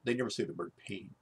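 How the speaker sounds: ordinary delivery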